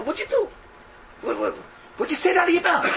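indignant telling-off, pitched high; angry rebuke. Acted. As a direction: tear into him for what he said. Acted rebuke of the brother.

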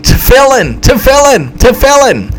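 high, loud repeated cry; imitated scream, each cry falling at the end. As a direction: scream it out word by word like a desperate young man. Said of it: "who screamed".

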